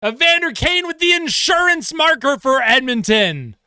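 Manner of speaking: high, sustained excited call; goal announcement. Real, not acted. Commentator announces Edmonton's insurance marker, excited proclamation. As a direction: proclaim the scorer, still fired up.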